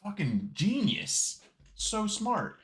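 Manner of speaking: mock-amazed compliment; stretched, admiring sarcasm; sarcastic awe. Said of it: "And I'm like".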